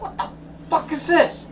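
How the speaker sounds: high, breathy near-whisper of disbelief; exasperated disbelief. Retold or imitated. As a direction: hiss it out, fed up with the ads. Quoting his own frustration.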